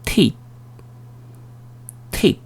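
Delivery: "테이프" as one English syllable, "tape"; short, separate "테이프", said "teip"; long A diphthong. Shown as a demonstration. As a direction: model "테이프" with "ei" gliding before a final p. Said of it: "A로 발음이 변하는 겁니다".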